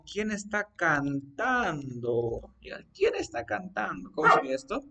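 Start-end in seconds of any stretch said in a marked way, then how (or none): 0.0-2.5 slow, stretched-out question; slow dictation. Shown as a demonstration. Teacher dictates what you'd say arriving home, slow dictation. 2.9-4.1 higher, quicker acted question; natural question. Shown as a demonstration. Someone arriving home asks who is singing, higher natural question.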